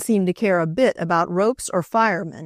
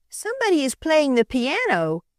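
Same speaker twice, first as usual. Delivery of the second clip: lightly voiced sleepy little girl, higher; a small girl half awake, a touch higher; little girl's voice; 8 semitones higher than usual. A narrator's reading. Little girl telling her mother at night, lightly voiced. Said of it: "answered the little girl".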